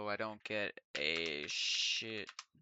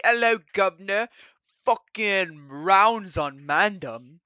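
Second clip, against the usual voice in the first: put-on cockney accent; 8 semitones higher, 18 dB louder than usual.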